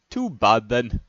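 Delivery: ordinary delivery, nothing marked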